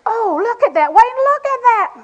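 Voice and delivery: the wife thrilled, pulling at her husband; gushing wife. Retold or imitated. Wife showing husband something she loves, gushing excitedly. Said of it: "And I say".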